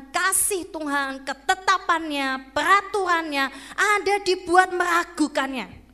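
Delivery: full-voiced pulpit warning; forceful preaching. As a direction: drive each point home to the congregation.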